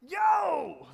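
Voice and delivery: big happy greeting shout; exuberant shout. Retold or imitated. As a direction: call out Yo like you just saw a friend. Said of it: "It's, it's exuberant".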